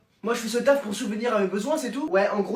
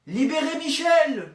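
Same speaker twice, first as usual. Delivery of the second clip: protest shout; 5 semitones higher, slower than usual. Acted. Student shouting as police take Michel, slow protest cry. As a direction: call it out like a rally slogan.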